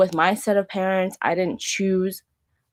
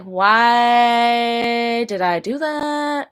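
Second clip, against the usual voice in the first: very slow, higher, stretched-out self-reproach; long-held, raised plea of exasperation; drawn-out exasperation; 4 semitones higher, much slower than usual. Retold or imitated. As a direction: stretch the vowels into a mock wail. Mock lament at her own behavior.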